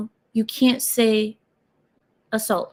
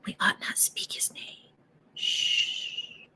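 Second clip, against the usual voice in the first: hushed secretive whisper; spooky conspiratorial hush; 15 dB quieter, much slower than usual. Pretend. Mock secretive hush at start.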